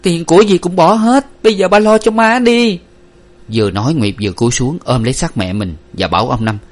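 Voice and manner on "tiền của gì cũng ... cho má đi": high, loud, urgent dismissal; brushing him off. A narrator's reading. Daughter cuts off father's talk of money, urgent dismissal. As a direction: cut him off, pleading loudly. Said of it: "Nguyệt gạt ngang".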